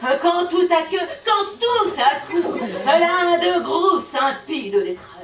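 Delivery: theatrical, drawn-out verse declamation; big stage recital voice; poem recitation. Acted. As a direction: declaim it grandly, groping for the next line.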